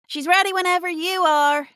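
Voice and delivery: high, loud called-out line; yelling back. Acted. Game master voices a woman yelling back from an office, calling out. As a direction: call out loudly down the hallway. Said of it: "yells back".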